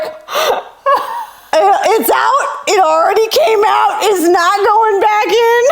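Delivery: high, laughing retelling. Retold or imitated. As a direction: giggle through the words as you say them. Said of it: "I was like".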